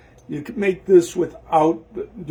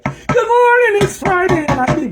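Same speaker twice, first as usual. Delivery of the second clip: silly high greeting; 7 dB louder than usual. Pretend. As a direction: sing out the greeting like a cartoon character. Sudden silly morning greeting.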